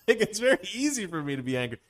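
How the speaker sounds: normal talking voice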